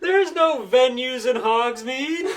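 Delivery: high, loud and slow through laughter; laughing disbelief. Real, not acted. Friend objects to the fanfic plot, laughing. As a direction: protest while cracking up.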